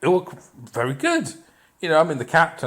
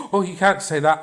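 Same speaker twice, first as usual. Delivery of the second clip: gasp, then a higher-pitched mock protest; mock-shocked objector; 7 semitones higher than usual. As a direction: imitate a scandalised critic. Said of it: "I think he was better".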